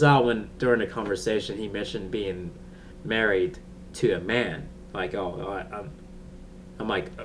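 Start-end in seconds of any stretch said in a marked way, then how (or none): none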